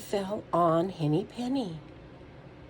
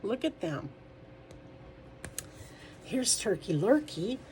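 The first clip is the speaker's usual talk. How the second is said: ordinary delivery, nothing marked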